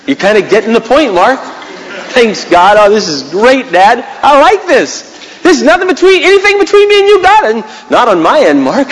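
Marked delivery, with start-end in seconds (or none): 2.1-7.4 joyful prayer. Pretend. Voices himself speaking to God.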